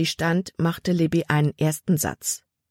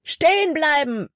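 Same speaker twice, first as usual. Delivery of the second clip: Hutton calling out; 11 semitones higher than usual. A narrator's reading. Slave catcher orders the runaways to stop, calling. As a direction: call after fleeing people to halt. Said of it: "rief Hutton".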